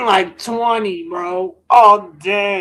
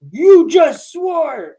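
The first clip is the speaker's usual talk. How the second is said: slow, loud, higher shout; teacher's scolding shout; 5 semitones higher, 6 dB louder, much slower than usual. Retold or imitated. Teacher scolding a student in the gym, slow shout. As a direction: yell it slowly like an angry teacher. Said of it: "And was like, Hey".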